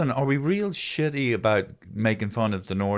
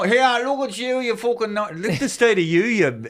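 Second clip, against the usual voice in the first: high jeering voice of a Dublin bully; high mock jeer; mocking Dubliner; 11 semitones higher than usual. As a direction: taunt them like a schoolyard slagger. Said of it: "do you ever, you know".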